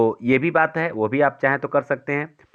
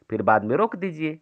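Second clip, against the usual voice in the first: ordinary delivery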